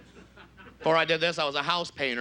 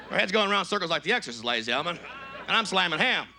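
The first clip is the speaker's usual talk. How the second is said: ordinary talk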